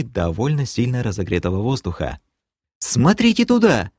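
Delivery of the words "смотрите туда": very high, startled call; piercing cry. A narrator's reading. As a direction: cry out sharply to point out danger. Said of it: "пронзительно вскрикнула успевшая вскочить девушка". (пронзительно вскрикнуть, высоко)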